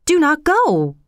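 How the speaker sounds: king pleading to keep his subject; insistent plea. A narrator's reading. King repeats plea more insistently.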